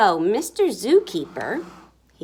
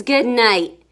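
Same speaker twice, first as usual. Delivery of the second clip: loud lion goodnight; big, loud lion voice; lion's roar; 9 dB louder than usual. A narrator's reading. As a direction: say it on the tail of a roar. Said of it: "the lion roars".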